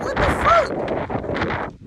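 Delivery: high breathless slow squeal; squeaky disbelief. Acted. Jealous man ranting about a rival, squeaking in disbelief. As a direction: let the voice shoot up into a thin airy squeak. Heartbroken rant peaks in disbelief.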